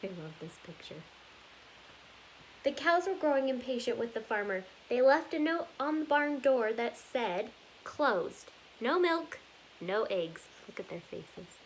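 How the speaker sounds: lively storybook read-aloud, rhythmic and bouncy; reading to children. Acted. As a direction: read it like story time for little kids. Reads picture book aloud.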